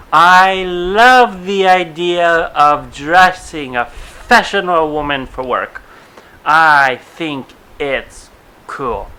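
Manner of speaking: loud, slow, drawn-out gushing; exaggerated quote voice. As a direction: ham up an excited contestant, slowly. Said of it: "Why I just said it like that".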